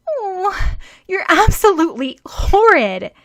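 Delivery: high, loud indignant cry; a girl scolding the boy for kicking the puppy; outraged scolding. A narrator's reading. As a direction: tell him off hotly.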